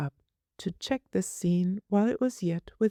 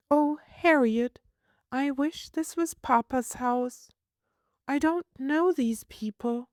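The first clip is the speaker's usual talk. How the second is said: put-on high, plaintive child voice; a small girl longing for home; homesick little girl; 10 semitones higher than usual. Child voice, sad.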